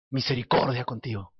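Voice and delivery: dropped soft and low. Acted. Preacher repeats the promise softly.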